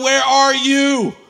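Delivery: slow, loud, high desperate plea; calling to God from the darkest place; crying out to God. Retold or imitated. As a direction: stretch it out like a lost cry. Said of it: "I was crying out to God".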